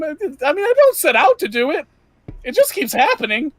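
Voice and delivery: put-on jumpy nervous young man rambling; nervous young Jack. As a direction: play Jack as an anxious, eager twenty-something. GM voices nervous NPC Jack.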